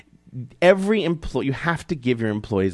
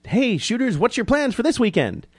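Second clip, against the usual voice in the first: high, mocking sing-song read of the tweet; high mock-cheery mimicry; 14 semitones higher than usual. Mock NRA tweet voiced high.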